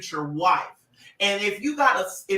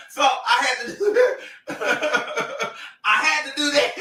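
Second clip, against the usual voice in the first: talking through real laughter; words breaking up into laughs; laughing; 4 semitones higher than usual. Real, not acted. Laughing at own story to live viewers, laughing.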